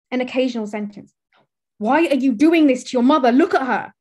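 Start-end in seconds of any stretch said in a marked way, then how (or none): 1.8-3.9 shouting. Acted. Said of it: "Someone shouts".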